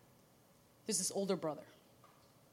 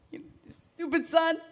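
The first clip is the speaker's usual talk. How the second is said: higher, slow, mock scolding; a hammed-up furious dad; mock angry father; 7 semitones higher, 3 dB louder than usual. Son imagining his father scolding him, mock angry and slow. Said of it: "ready for the father to go".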